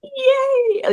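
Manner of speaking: squeaky high sarcastic "yay"; fake enthusiasm. Mocking her own fake cheer on the show, very high and hollow. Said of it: "And I'm like".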